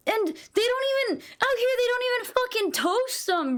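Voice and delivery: high-pitched outraged rant, pushing up in disbelief; indignant rant. Real, not acted. Streamer venting to chat about cold bagels, high outraged rant. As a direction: sound scandalised that nobody toasts the bagels.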